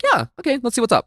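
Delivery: squeaky high, breezy yes to anyone; high carefree mock voice. To a podcast host, mocking her old dating self in a high voice.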